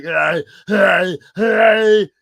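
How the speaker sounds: high, loud mock Arnold grunting hey; Arnold Schwarzenegger impression. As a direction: bark out hey like a muscle-bound action star. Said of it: "your impression of, like, Arnold Schwarzenegger".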